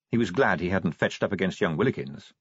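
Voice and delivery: usual speaking voice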